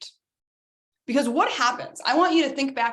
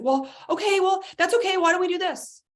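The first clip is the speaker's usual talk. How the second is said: fixer friend; 10 semitones higher than usual. Acted. Someone fixing your emotion.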